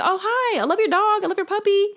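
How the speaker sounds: high, gushing, sugary chatter to strangers; high gushing compliments to strangers in the park; bubbly compliments. Pretend. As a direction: gush compliments in a high chirpy voice. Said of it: "Y'all need to say".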